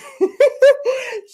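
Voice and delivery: loud, high burst of real laughter. Real, not acted. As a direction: crack up laughing.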